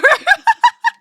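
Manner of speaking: high, loud burst of laughter; loud, rapid, high giggling; real laugh. Real, not acted. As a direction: crack up in a high cackle. Laughs at own cry counter claim.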